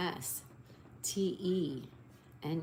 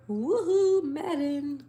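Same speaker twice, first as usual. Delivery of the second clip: much higher, louder whoop; excited cheer; 8 semitones higher, 7 dB louder, slower than usual. Real, not acted. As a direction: cheer like you spotted a friend. Friend's name found in puzzle.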